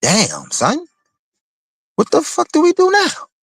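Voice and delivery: mock bewildered warriors left without a leader; confused young warrior. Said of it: "you got the warriors like".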